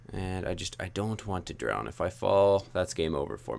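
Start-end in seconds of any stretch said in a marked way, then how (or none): none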